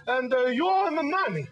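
put-on dad's thick Italian accent. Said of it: "thick Italian accent".

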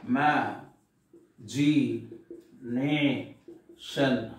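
"Ma, g, nation" with a long pause between each part; separated syllables. Shown as a demonstration. Teacher showing five syllables, "Ma, g, nation" spoken piece by piece. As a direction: space out "Ma", "g", "nation" as isolated syllables.

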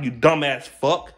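ordinary talk

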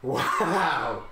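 loud, drawn-out exclamation; big wow. Pretend. Player reacting to the game, loud drawn-out wow. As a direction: let out a long loud wow.

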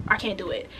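ordinary delivery

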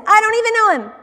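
high, loud protest; acted outburst of denial; indignant denial. A narrator's reading. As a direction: deny it emphatically, pitch jumping up. Acts out Peter's denial.

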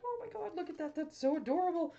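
high, delighted gushing; awed sigh. Real, not acted. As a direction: gush over something adorable. Admiring finished card.

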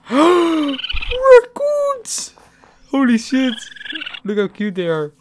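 high, loud delighted squeal; excited shriek. Real, not acted. Spots raccoons in game.

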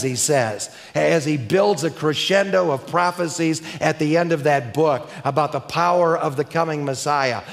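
ordinary talk

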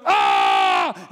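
sudden straining yell; a short strained hulk grunt; hulk-out roar. Retold or imitated. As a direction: roar as the camera piece gives way. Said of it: "I hulked out".

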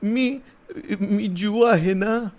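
put-on high, slow tearful little-girl voice; frightened girl confessing while starting to cry; starting to sob. A narrator's reading. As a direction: sniffle through the words. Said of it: "हळूहळू मुसमुसायला लागत मुलगी म्हणाली".

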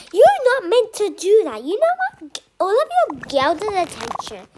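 high, loud teacher scolding; teacher yelling at the class to pay attention; angry scolding. Acted. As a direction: tell them off at full volume.

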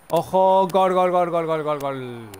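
loud, high, rapid-fire goal shout falling at the end; goal call. Pretend. Commentator celebrating a goal for Cataluña, shouted goal call. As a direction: belt out a chain of goals on one breath.

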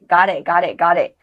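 "got it" run together, t silent, like "ga-leh"; linked got it. Shown as a demonstration. Teacher drilling the linked "got it" for the class. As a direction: blend "got it" fast with the t dropped. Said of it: "特是不发音的，连到一起".

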